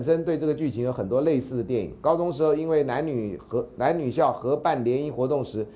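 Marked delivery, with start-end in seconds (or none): none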